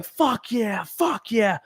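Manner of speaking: high, held-back mock shout; imitated kid's yell. Retelling an eight-year-old crowd surfer, softened high yell. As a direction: yell it like a kid, but keep the volume down. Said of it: "and he's yelling".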